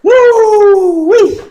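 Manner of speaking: high loud woo-wee shout; loud whoop. Real, not acted. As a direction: whoop with delight. Reacting to joke.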